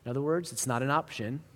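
usual speaking voice

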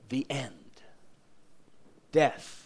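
hushed, soft whisper. Acted. Preacher whispering the grim end to the congregation. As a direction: let it fall to a whisper. Dramatic pause after sad ending.

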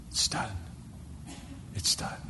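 near-whispered, very soft declaration; hushed finality. Acted. Preacher quotes God finishing his plan, hushed finality. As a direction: let it out as a hushed pronouncement.

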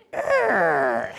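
high, loud mock gorilla roar; playful growl from a tiny gorilla; baby gorilla roar. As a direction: roar like a cheeky little ape. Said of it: "And he goes".

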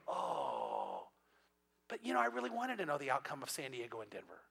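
hammed-up letdown, trailing into a quiet complaint; mock disappointed. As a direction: play at being let down, softly. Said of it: "you're gonna be disappointed".

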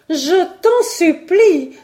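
high, loud, drawn-out plea; pleading cry. A narrator's reading. Young woman begs her lover to heed the threat, pleading cry. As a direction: implore him, crying out slowly. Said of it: "s'écriait Simone".

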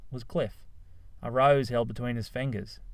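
ordinary delivery, nothing marked

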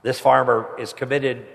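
normal talking voice

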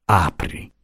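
calm, low and soft single word; placid. A narrator's reading. Tired old uncle calmly tells Franco to open it, placid. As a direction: say it unbothered, lying in bed. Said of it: "Disse placidamente".

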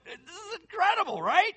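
excited. Real, not acted. Excited reaction to scripture.

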